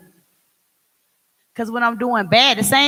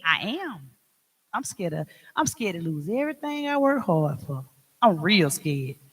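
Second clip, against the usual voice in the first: quiet admission; 4 semitones lower, 7 dB quieter than usual. Real, not acted. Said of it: "You scared".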